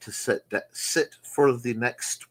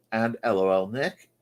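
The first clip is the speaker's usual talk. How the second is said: ordinary talk, nothing marked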